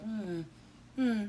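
soft, unbothered hum; mock indifference. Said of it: "they're like".

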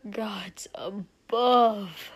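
slow, breathy agonized hiss. A narrator's reading. Nauseous prince after smelling the drink, hissing. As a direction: drag it out in a hiss. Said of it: "Shoto hissed".

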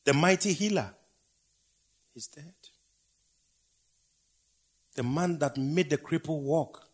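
loud high cry that drops to a hush on "is dead"; preacher's rising lament. Acted. Preacher lamenting to the congregation, shouting the titles, hushing "is dead". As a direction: proclaim each title, then let it die. Building cries, then falling whispers.

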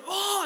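a sudden high startled "Oh"; startled gasp. Retold or imitated. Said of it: "I went".

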